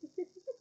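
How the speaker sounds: short real laugh. Real, not acted. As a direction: laugh at the scene. Narrator laughs.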